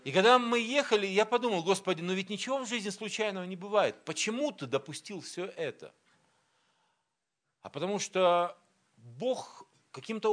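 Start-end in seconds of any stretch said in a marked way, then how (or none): none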